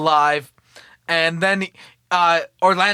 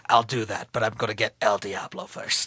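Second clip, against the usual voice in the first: much lower, softer tough-guy mutter; gruff Chev voice; 8 semitones lower, 7 dB quieter than usual. Retold or imitated. Chev brushing off the doctor in a low voice. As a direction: drop into a hint of a gritty action hero.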